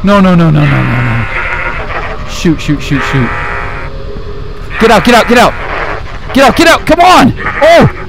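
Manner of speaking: high, loud terrified shouts; panicked screaming. Real, not acted. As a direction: scream as you're trapped. Trapped and attacked.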